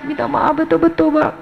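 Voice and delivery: mock crying plea to a husband; acted tearful apology. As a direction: beg forgiveness as if weeping. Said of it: "Kita akan menangis".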